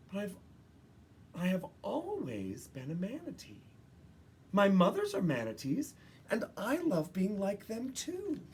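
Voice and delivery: Maggie's thoughts. Acted. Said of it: "Maggie thought to herself".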